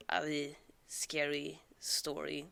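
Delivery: plain reading voice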